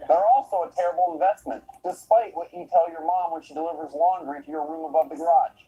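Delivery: usual speaking voice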